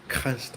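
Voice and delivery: KANNST with a hard K from the throat; German demo, KANNST formed far back. Shown as a demonstration. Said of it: "it's all at the back of the throat".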